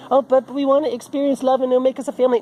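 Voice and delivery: high, loud sing-song parody; mocking whiny voice. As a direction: mimic them, naive and sugary. Mocking the couple's reasoning.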